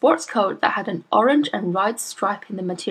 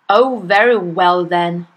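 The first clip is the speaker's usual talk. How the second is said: slow, louder grudging snap; 6 dB louder, slower than usual. A narrator's reading. Father grudgingly lets the teacher in, snapping. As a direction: give in with a snarl. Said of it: "he snapped".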